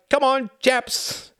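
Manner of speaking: high, slow mock rallying call; hammed-up cheery rally cry. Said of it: "He's crying out".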